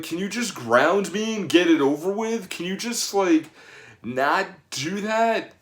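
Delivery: put-on begging like a fed-up kid who'd rather be grounded; pleading teen.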